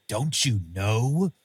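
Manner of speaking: "Don't you know?" drawn out slowly, rising on "know"; imitated odd line reading. Mocking an actor's line reading, "Don't you know?" slow with a rising "know". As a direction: stretch each word of "Don't you know?" and lift "know" at the end. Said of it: "I love how he, he phrases".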